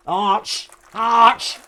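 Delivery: "Arch" rising into a loud sneeze; posh sneeze. Shown as a demonstration. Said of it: "like a posh sneeze".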